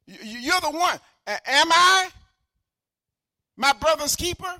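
loud, rising sermon delivery; preaching. Acted. Pastor urging the church to love others, preaching loudly. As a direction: preach it out to the congregation.

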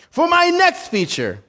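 high-pitched big-top announcement; showman announcing. Acted. Tightrope walker announces next feature, grand showman. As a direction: proclaim it like a stunt performer.

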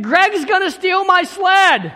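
high, loud and slow shout of alarm; retold shout. Boy shouting to his brother about the stolen sled. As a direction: yell it to your brother, panicked. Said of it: "I said".

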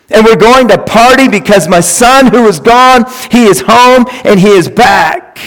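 high, loud, ringing sermon voice; preaching at full force. Acted. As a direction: proclaim the father's celebration to the whole room.

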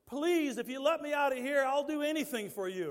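high, loud pleading to God; desperate prayer. Pretend. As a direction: beg God to get out of prison. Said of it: "You know what I'd be doing?".

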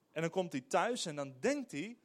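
ordinary delivery

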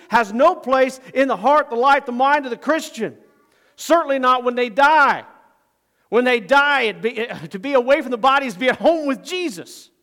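loud, high, emphatic sermon delivery; impassioned pulpit voice; full-force preaching. Acted. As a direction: drive the point home to the congregation.

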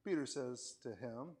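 ordinary delivery

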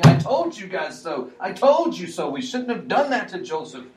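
high, loud I-told-you-so to the brothers; Reuben scolding. Acted. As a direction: scold them like the brother who warned them.

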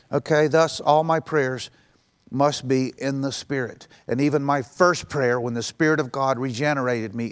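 normal talking voice

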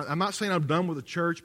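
ordinary delivery

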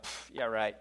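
puff, then low dismissive mumble; retold scoff. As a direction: brush it off with a quiet mock scoff. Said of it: "and they're like".

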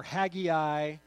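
Haggai in three syllables, HAG-ee-eye, slow and loud; alternative pronunciation. Shown as a demonstration. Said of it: "however you want to say it".

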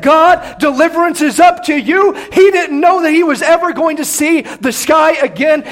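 loud, high, shouted build; preaching at full force. Real, not acted. Preaching climax.

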